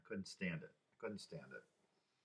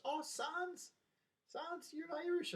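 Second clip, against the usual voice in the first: very high-pitched, put-on Irish boss voice; slower than usual. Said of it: "I worked for an Irish guy".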